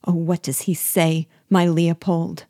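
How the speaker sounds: ordinary delivery, nothing marked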